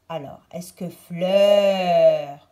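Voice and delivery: fleur stretched slow and loud; stressed test word. Shown as a demonstration. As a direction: lean on fleur to make them listen. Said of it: "c'est comme de?".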